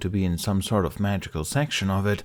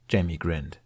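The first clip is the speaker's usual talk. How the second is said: usual speaking voice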